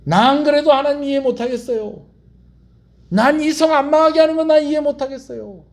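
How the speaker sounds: high, held-up defiant complaint; stubborn refusal. Acted. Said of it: "이러고 가버렸다는 거예요". (요나가 고집부리듯 높은 목소리로)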